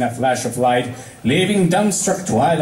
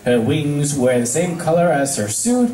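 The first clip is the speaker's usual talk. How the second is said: ordinary delivery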